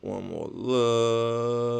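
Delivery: slow the last repeat right down; final repeat stretched slowly for emphasis; drawn out. Pretend. Coder repeating phrase for effect.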